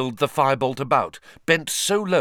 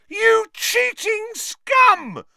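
slow howl through a megaphone; toy megaphone; 5 dB louder, much slower than usual. A narrator's reading. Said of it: "howling into the megaphone".

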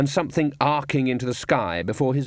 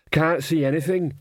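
raised, higher-pitched call; mild shout; 5 semitones higher than usual. A narrator's reading. Cliff answering on the rocking cart, mild shout. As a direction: call it over the rattle of the cart. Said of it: "shouted Cliff".

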